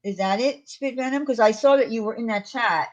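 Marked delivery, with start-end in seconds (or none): none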